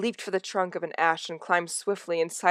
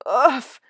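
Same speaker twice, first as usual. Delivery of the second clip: squashed grunt; 8 semitones higher, 9 dB louder than usual. Acted. Squashed under heavy body.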